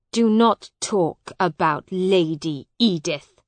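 loud, stern command; angry warning. A narrator's reading. Hugh forbids Miles to speak of Edith, angry. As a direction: warn him off angrily. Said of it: "said Hugh angrily".

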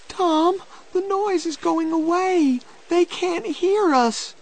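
put-on very high, fearful girl's voice; very frightened. A narrator's reading. Becky hearing the rescuers' noise go away, frightened. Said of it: "She was very frightened".

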